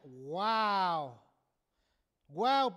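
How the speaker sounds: loud, drawn-out wow of discovery; big wowed reaction; amazed wow. Real, not acted. Amazed at the word's meaning.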